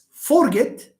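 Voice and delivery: "forget" stressed high and hard on FOR; stress on first syllable. Shown as a demonstration. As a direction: punch FOR, drop the end of "forget". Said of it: "it's a common mistake in the pronunciation".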